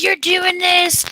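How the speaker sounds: high, drawn-out accusing quote; mock accusation. Retelling one woman accusing another, high mock accusation. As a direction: stretch it out high, hammed up.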